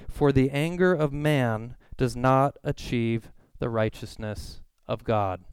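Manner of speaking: slow, weighty scripture reading; solemn Bible reading, slow. Acted. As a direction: read the verse slowly, letting each phrase land.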